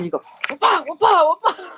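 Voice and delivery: high loud wapa wapa smacks; imitated smacking. Friend demonstrating the paint stick, loud mock smacking sounds. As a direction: mimic the stick hitting with your voice. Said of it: "She said".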